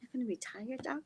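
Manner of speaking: high and soft, incredulous; softly imagined disbelieving question; retold inner question. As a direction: think it aloud in a small high voice. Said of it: "In my mind, I'm like".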